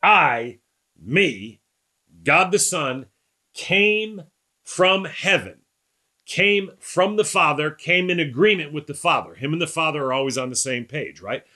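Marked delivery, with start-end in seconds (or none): 0.0-9.4 much higher, slow, weight on "came"; emphatic paraphrase. Retold or imitated. Pastor voices Jesus' claim to the class, emphatic and slow. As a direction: hammer each "came from" slowly. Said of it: "That's Jesus saying".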